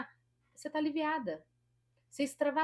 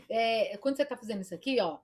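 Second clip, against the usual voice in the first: ordinary talk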